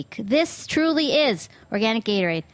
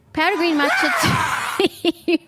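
high, loud, laughing shout of the words; mock victory scream; jubilant shout; 8 semitones higher, 4 dB louder, slower than usual. Real, not acted. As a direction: whoop it out with a laugh. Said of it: "more jubilant than I remember".

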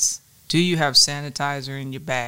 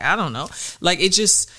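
ordinary talk

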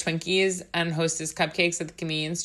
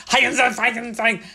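mock German jabber, higher and louder; fake German gibberish; 6 semitones higher, 4 dB louder, faster than usual. Host mocking film's fake German, gibberish German accent. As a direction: imitate movie villains jabbering in pretend German. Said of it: "speaking gibberish they think sounds like German".

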